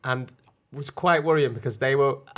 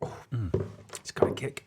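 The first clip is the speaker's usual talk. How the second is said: ordinary talk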